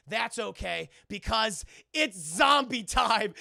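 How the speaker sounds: loud, slow, drawn-out announcement; hammed-up movie villain declaring chaos, loudly; mock villain proclamation. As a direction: proclaim it big, stretching the key words. Retelling the hacker's triumphant line.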